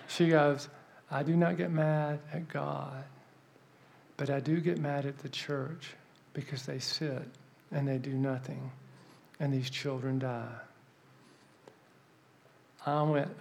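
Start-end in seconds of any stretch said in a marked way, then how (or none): 1.1-10.5 soft, deliberate and solemn; grave rebuke. Retold or imitated. The older woman blames the idle church, grave quiet rebuke. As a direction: deliver a quiet, measured reproach.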